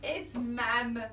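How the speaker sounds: ordinary delivery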